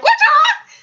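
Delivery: loud, very high chicken screech; chicken squawk. Pretend. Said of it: "I actually sound like a chicken when I do that".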